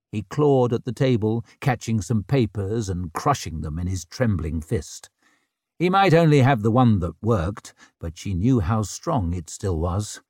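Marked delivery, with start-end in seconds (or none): none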